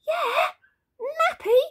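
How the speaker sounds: very high, bouncy squeal; gleeful insistence. A narrator's reading. Dad answers baby's refusal, high and cheery. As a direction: pipe it up high, teasing the baby.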